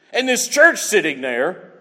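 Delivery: normal talking voice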